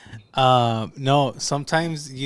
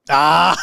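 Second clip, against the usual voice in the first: loud, sudden playful cry; short playful yelp; 3 semitones higher, 8 dB louder than usual. Real, not acted. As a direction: yelp out at your own joke. Playful cry after joke.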